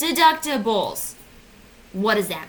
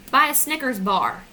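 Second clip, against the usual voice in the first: usual speaking voice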